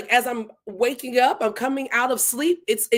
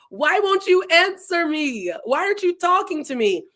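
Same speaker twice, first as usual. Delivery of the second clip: acted-out Job pleading with God, higher pitch; imploring complaint; 6 semitones higher than usual. Retelling Job's complaint to God.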